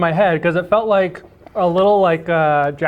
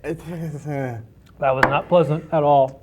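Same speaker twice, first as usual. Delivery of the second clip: pained; 3 semitones lower, slower than usual. Real, not acted. After eating hot sauce.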